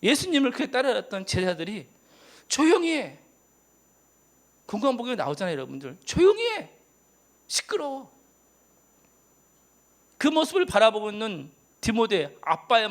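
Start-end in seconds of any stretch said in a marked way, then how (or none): 2.5-3.2 sharp, higher, louder "shut up"; hushing rebuke. Retold or imitated. Disciples hushing the blind man. 6.0-8.1 high, sharp scolding; much higher mocking rebuke; scornful hush. Disciples hushing the blind man.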